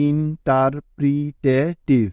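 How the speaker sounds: Interpretative drawn out slowly, each part separate; teacher slowly spelling out interpretative by sound; slow, syllable by syllable. Shown as a demonstration. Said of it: "প্রথমে ধীরে ধীরে".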